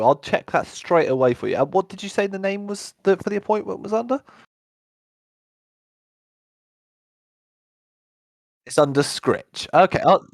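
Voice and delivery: a fussing guard promising to check straight away; helpful guard. Acted.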